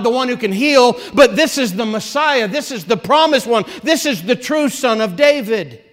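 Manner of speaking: climbing pulpit fervour; fervent preaching. Acted. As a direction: preach it out with growing force. Preacher builds to a climax.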